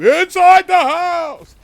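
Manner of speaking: huge high, drawn-out shout; campy full-volume shriek; melodramatic horror reveal. Acted. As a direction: belt out the twist, stretching every word. Hammed-up climax of the horror story.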